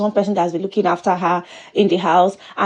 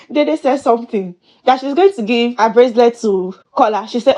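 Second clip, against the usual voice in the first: ordinary talk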